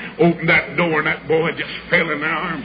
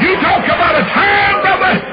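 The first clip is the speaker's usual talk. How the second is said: high loud climactic cry; preaching shout; 9 dB louder than usual. Acted. Preacher to the congregation, high ringing shout. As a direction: lift it up at full force.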